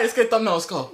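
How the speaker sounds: normal talking voice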